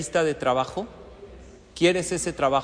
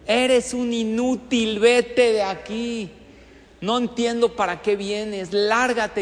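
high, slow angry shout at the candidate; furious interviewer yelling insults; acted yelling boss; 5 semitones higher, 6 dB louder, slower than usual. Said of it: "te empieza a gritar".

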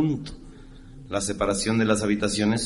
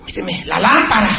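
mock woman demanding; 8 semitones higher, 8 dB louder than usual. Woman voiced through the wall.

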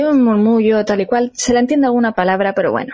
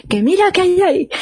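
sleepwalker insisting; 10 semitones higher than usual. Retold or imitated. Sleepwalking sister insists, retold.

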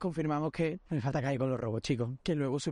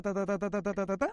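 rattled-off filler; 4 semitones higher than usual. Retold or imitated. Mimics a string of questions.

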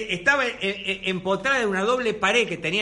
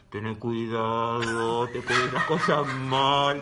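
low, slow ghostly voice; slow eerie spirit speaking low; 7 semitones lower, much slower than usual. Retold or imitated. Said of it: "la tetera te hablaba, aparentemente un espíritu".

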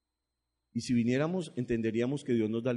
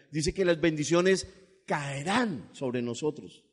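ordinary delivery

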